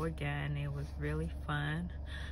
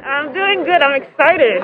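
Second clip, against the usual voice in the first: loud, higher, over engine noise; shouting excited; 9 semitones higher, 23 dB louder than usual. Real, not acted. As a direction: yell it over the roar. Shouting in plane before jump.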